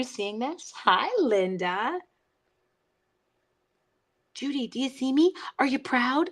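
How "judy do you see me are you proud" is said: a hint of an eager little kid, pitched up; lightly childish plea for approval. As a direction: ask like a kid seeking praise. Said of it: "I sound like a little kid".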